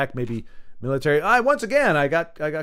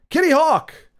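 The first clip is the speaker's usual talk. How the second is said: excited; 14 semitones higher, 7 dB louder, slower than usual. Real, not acted. Excited call on seeing plane.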